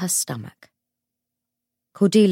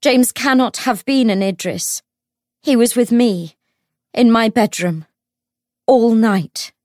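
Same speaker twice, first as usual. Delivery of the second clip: high, forceful public confession; bold, ringing testimony; very loud declaration; 6 semitones higher, 7 dB louder than usual. A narrator's reading. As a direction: proclaim each phrase loudly to the room. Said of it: "in a voice so loud".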